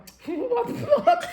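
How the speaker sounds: high burst of laughter; giggling at having to whistle; real laugh. Real, not acted. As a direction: laugh helplessly at the idea.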